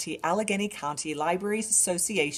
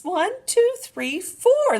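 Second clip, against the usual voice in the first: rising, louder count; excited counting; 8 dB louder, slower than usual. Shown as a demonstration. Librarian counting a duckling's feet excitedly. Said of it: "Let's keep going".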